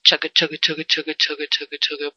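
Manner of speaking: chanted chugga chugga like a train engine; playful train-engine chant; chugga chugga machine noise. Pretend. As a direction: chug it out like a little machine. Machine sound for function.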